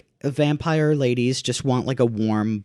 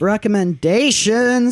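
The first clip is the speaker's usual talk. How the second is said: drawn-out announcement; 9 semitones higher, 6 dB louder, much slower than usual. Acted. Dramatic segment announcement.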